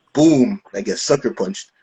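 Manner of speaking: ordinary talk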